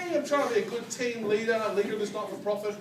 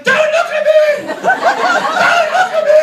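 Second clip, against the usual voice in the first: much higher, very loud laughing shriek; yelling; 16 dB louder than usual. Retold or imitated. Yelling at laughing staff from the ground, loud shriek. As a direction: shriek at the staff to look away. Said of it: "I'm yelling".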